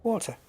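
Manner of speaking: "Water" with a clear, crisp T; soft, quick "Water" with a sounded T; T pronounced. Shown as a demonstration. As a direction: hit the T in "Water". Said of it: "I pronounce the T".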